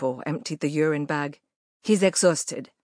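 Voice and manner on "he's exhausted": put-on broad Quebec French accent, firm nurse; nurse's coarse Quebec French accent. A narrator's reading. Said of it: "in her broad Quebec French".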